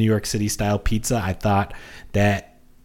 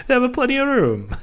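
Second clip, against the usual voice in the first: laughing; 4 dB louder than usual. Real, not acted. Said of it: "Now I said have a".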